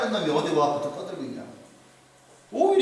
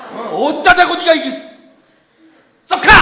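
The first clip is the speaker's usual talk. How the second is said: angry scolding; 6 semitones higher, 10 dB louder than usual. Retold or imitated. Brother scolding David.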